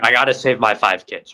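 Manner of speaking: put-on loud, urgent cry to save the kids; desperate dad. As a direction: push it out hard, a father in panic. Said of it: "He's like".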